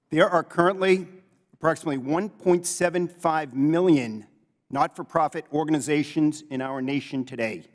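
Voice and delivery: ordinary talk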